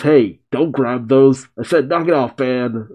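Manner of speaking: ordinary delivery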